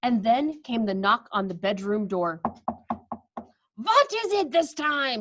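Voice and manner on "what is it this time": slow, irritated loud question; shouted. Acted. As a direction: snap at the knock. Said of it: "shouted the Grand High Witch".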